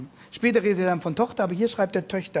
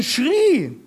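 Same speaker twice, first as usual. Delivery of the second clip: schrie called out high and loud; emphatic schrie; 9 semitones higher, 8 dB louder than usual. Shown as a demonstration. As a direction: let the word schrie ring out. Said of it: "kann auch übersetzen". („schrie“ hoch und laut hervorgehoben)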